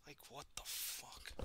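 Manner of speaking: breathy whispered curse; muttered whispered swear. Real, not acted. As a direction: hiss it under your breath. Muttering in disbelief.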